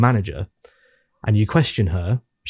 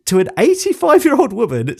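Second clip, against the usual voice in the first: usual speaking voice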